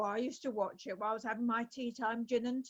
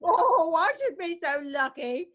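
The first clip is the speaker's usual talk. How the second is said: mock delight; 5 semitones higher, 7 dB louder than usual. [name] teased about a toy boy.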